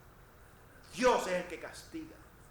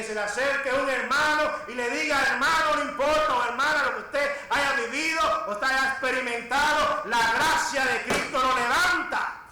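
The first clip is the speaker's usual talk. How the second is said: loud, raised exhorting voice; full-force preaching; 6 semitones higher, 11 dB louder than usual. Acted. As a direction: urge the congregation on at full volume. (exhortación de púlpito a plena voz)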